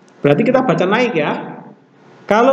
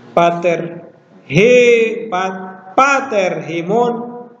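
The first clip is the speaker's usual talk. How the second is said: slow reading demo; slower than usual. Shown as a demonstration. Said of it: "Ini dibaca naik".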